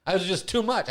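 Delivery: ordinary delivery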